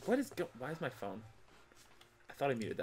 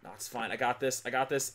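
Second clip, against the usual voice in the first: normal talking voice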